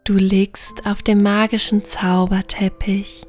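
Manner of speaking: plain reading voice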